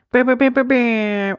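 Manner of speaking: lips buzzing like a DJ horn blast; mock airhorn. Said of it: "I'm gonna change it to the beginning".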